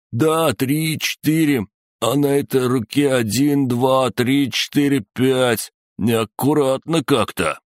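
high, teasing counting; playful torturer. Acted. Torturer counts fingers for laughing bandits, playful high voice.

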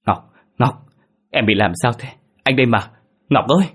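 alarmed concern. A narrator's reading. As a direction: call her back to her senses. Husband alarmed at panicking wife. (lo lắng gọi vợ)

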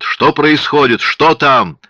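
loud high frantic shouting. A narrator's reading. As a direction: keep yelling, desperate to know. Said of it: "кричал Бомонт".

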